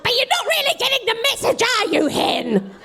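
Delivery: high squawky duck needling the hen. Acted. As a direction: duck telling the hen she's missing the point. Duck character speaking.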